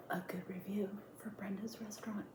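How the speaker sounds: hushed whisper. Real, not acted. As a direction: whisper the reveal. Dramatic reveal of Yelp review.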